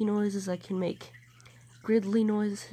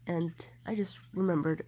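normal talking voice

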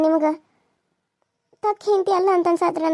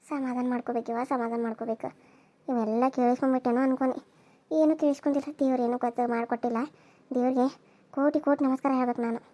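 much softer and lower, to herself; hushed aside; 6 semitones lower, 9 dB quieter than usual. Acted. Wife aside to herself, relieved her husband heard nothing: hushed and low. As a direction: murmur your relief so he can't hear.

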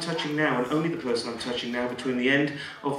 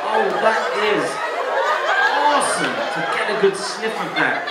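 loud, high, drawn-out delight; rapturous coaxing; 8 semitones higher, 7 dB louder, slower than usual. Acted. Stage hypnotist urging volunteers to sniff, rapturous coaxing. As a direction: savour every sniff, slow and gushing.

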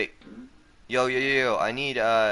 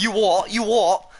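higher, louder cockney "You what?"; mock British; 9 semitones higher, 6 dB louder than usual. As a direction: tease the accent back, laughing. Mocking friend's British accent.

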